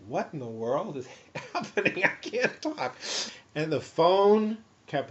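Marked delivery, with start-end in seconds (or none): 0.1-3.0 rising, incredulous inner voice; bewildered, climbing higher toward the end; retold bewildered thought. Patient finding his speech failing, bewildered. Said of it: "think to myself".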